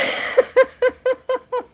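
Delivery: long high giggle; high laugh. Real, not acted. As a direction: giggle helplessly. Laughing at hiccups.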